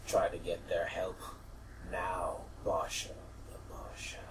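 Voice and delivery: low, slow, fading to whisper; mysterious disembodied voice. Acted. Vision's voice urges seeking help, hushed and fading. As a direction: murmur it low and let it die away. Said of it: "And then you hear a voice".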